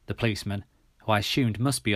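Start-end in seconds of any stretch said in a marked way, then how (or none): none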